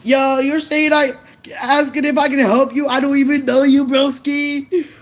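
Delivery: a hint of a laid-back bro brushing off a stranger; casual bro voice. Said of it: "I'm like".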